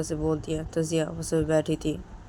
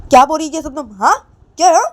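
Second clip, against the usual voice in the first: higher and louder; angry demand; 11 semitones higher, 13 dB louder, faster than usual. Acted. Jiya sitting up, higher louder angry demand. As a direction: confront sharply.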